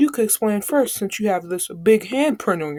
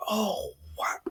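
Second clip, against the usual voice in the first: shocked whisper; 8 dB quieter than usual. Retold or imitated. Classmates shocked by the slap.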